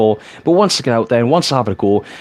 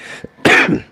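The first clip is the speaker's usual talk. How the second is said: coughing fit. Real, not acted. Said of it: "I'm coughing a lot again".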